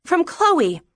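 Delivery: put-on high-pitched young girl; defiant child. Daughter standing up to father over sister's letters, insistent.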